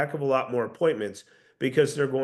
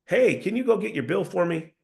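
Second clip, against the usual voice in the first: "bill for me" asked on a raised pitch; higher, permission-seeking question; upward tonality; 4 semitones higher than usual. Shown as a demonstration. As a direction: ask "can you go" as if seeking permission. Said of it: "All of it was an upward tonality".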